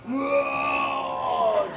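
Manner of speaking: high, whooping "Whoa!" shout; party whoop. Retold or imitated. Todd at the Christmas party cuts loose with a whoop. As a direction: let out a big party whoop. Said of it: "he was like".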